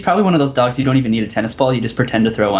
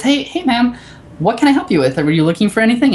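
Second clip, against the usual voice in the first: high, bright customer-service greeting, higher; imitating a chipper specialist; 10 semitones higher than usual. Retelling the Genius Bar story, imitating the eager specialist, higher. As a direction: play the eager store employee greeting her. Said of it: "And the specialist says".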